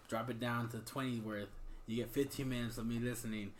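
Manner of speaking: bargaining tone. Retold or imitated. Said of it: "you should have been like".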